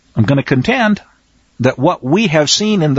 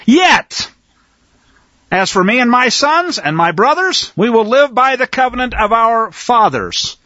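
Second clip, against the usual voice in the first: dramatic scripture reading; 5 semitones higher, slower than usual. Acted. Preacher voicing Matthias refusing the king, defiant. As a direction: declare it as a sworn oath.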